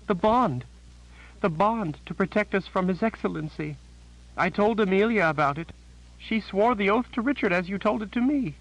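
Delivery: high, shaken voice of a terrified sister; a scared woman blurting out bad news; frightened Janet. A narrator's reading.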